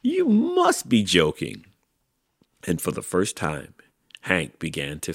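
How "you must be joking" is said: high-pitched incredulous owl. A narrator's reading. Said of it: "said the owl".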